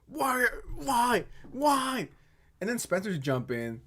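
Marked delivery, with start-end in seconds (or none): 0.1-2.1 higher, whiny fake sob on each why; mock wailing. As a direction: ham up a tearful plea. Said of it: "Mama Max is genuinely tripping".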